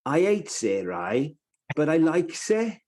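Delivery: imitating a broad Cardiff kid; real Cardiff accent. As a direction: do a strong Cardiff Welsh accent. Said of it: "he was really Cardiff".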